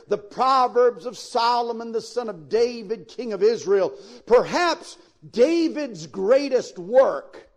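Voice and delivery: ordinary talk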